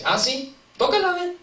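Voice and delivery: high, mocking dare, rising sharply; taunting dare. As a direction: goad him to touch it. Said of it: "Y dice".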